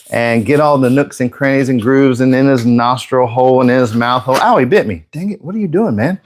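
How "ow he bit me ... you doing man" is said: mock startled. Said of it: "I'm just kidding, he didn't bite me".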